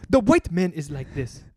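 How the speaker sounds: slow mock African accent; put-on African accent. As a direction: declaim slowly like an African comic, accent put on. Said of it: "that's my African guy".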